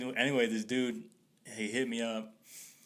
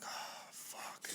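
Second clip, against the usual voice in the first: sighed, voiceless oh-no reaction; whispered dismay; 16 dB quieter than usual. Retold or imitated. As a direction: let it out under the breath, dreading trouble. Retold dread as confrontation starts.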